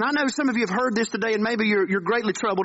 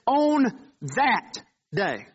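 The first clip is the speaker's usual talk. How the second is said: On that day drawn out slowly, each word weighted; slow, deliberate emphasis on On that day; slow stressed quote; much slower than usual. Shown as a demonstration. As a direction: linger on On that day to make them notice. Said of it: "But notice what he says".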